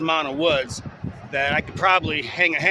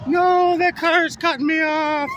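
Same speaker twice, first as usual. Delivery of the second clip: startled; 12 semitones higher, 3 dB louder than usual. Real, not acted. Car cutting him off.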